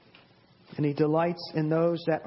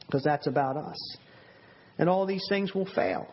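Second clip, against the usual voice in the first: ordinary talk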